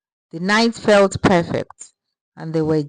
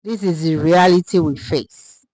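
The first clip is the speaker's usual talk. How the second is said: usual speaking voice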